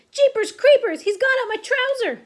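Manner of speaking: loud, startled shout, starting high and dropping to resolve. A narrator's reading. Cook with a mouse up his trouser, shouting. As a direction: exclaim in alarm, then rally the others. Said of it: "the cook shouted".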